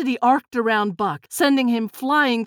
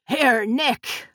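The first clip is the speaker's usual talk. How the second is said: calling out. A narrator's reading. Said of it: "Lily called out".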